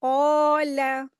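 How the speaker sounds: क़ाला with the first a stretched long and heavy; long a in Qala. Shown as a demonstration. Teacher to students, modelling the recitation: क़ाला with a drawn-out heavy a. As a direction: draw out क़ाला slowly, heavy qaaf. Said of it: "ऐसे पढ़ा जाएगा".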